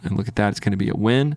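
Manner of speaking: ordinary delivery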